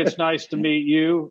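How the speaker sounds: ordinary talk, nothing marked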